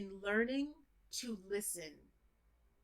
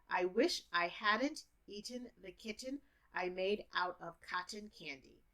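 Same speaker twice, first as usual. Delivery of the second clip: teacher speed; 4 dB quieter than usual. Shown as a demonstration. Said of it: "if I'm a teacher, you understand me".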